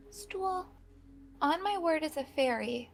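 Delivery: high, quiet and earnest; soft sincere promise. Acted. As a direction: promise it gently like a fairy. Fairy swears an oath softly.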